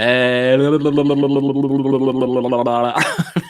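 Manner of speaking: long rolling blah-blah tongue burble; babbling. Pretend. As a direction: roll a long stream of nonsense syllables. Long babbled filler before reading chat.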